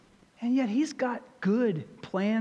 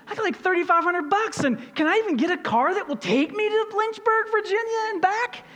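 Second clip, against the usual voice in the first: worried son; 11 semitones higher, 3 dB louder than usual. Retold or imitated. Son's worried question retold.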